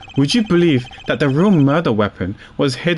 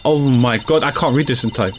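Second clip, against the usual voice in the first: usual speaking voice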